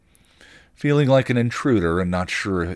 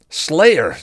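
higher, loud howl of warning; 9 semitones higher, 9 dB louder than usual. A narrator's reading. Spike howling a warning to Buffy, loud. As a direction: howl it to warn her. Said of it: "Spike howled".

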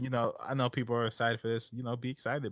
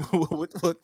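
usual speaking voice

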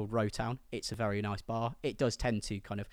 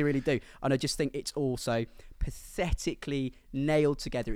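ordinary talk, nothing marked

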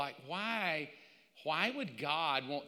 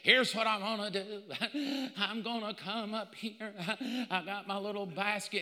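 higher voice, laughing between words; giggly prophet lady; 5 semitones higher than usual. Retold or imitated. Prophet lady pitching prophecies to church, giggling. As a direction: play the prophet, giddy about collecting. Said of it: "She said".

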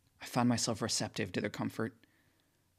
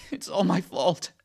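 high, slow, broken whimper; tearful whimper of self-blame; 8 semitones higher, 9 dB louder than usual. A narrator's reading. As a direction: choke it out through sobs. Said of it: "I whimper".

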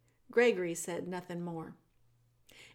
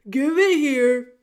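put-on loud, booming old jailer who speaks of himself by name; the jailer Gregory greeting Meg in a big theatrical voice; 6 semitones higher, 15 dB louder, slower than usual. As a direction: boom it out like a grand old jailer. Gregory demands the tray.

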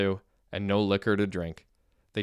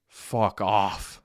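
scoffing. A narrator's reading. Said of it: "scoffed Arlov".

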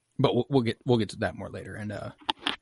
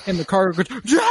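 high, loud laughing shout; mock alarmed cry while laughing; 9 semitones higher, 7 dB louder than usual. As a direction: blurt it through laughter. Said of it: "then he's like".

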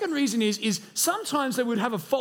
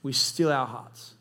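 low, reverent pastoral prayer; leading prayer; 6 semitones lower than usual. Real, not acted. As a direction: pray aloud for the room, low and unhurried. Leads congregation in prayer.

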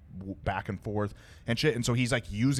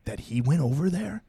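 higher, swooping narrator voice; Swamp Stories narrator impression; 5 semitones higher, 4 dB louder than usual. Imitating the YouTuber's way of talking, suspenseful narrator. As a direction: tease the twist like a storytelling YouTuber. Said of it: "he kinda sounds like this".

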